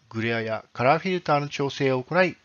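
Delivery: usual speaking voice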